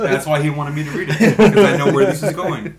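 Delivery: loud words through laughter; laughing. Real, not acted. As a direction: say it while cracking up. Laughing while reading crude ad.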